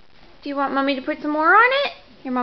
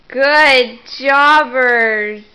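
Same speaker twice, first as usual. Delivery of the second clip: slow, loud drawn-out praise; 3 semitones lower, 6 dB louder, much slower than usual. Real, not acted. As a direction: stretch it out, beaming.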